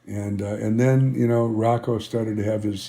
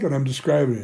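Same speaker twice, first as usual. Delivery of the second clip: ordinary delivery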